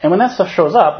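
ordinary talk, nothing marked

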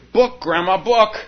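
put-on eager little kid asking for a book; toddler's voice. Toddler begging grandma for a book, high and eager.

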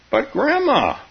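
put-on high-pitched childish objection; child's protest. A narrator's reading. Grandchild objecting to Grandma about dying, high protest.

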